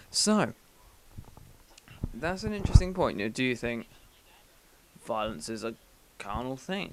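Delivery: ordinary talk, nothing marked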